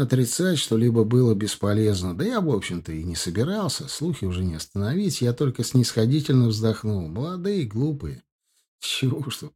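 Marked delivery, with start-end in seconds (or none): none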